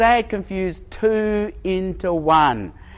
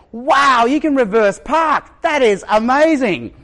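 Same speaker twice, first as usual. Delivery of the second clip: loud, higher mock amazement; patronizing; 7 semitones higher, 5 dB louder than usual. Bystander praising a Chinese man's parking, loud mock awe. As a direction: overdo the praise, talking down. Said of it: "it's so patronizing".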